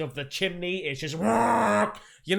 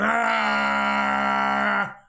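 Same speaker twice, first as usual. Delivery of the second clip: bloke's yell; 3 dB louder than usual. Retold or imitated. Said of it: "some bloke just going".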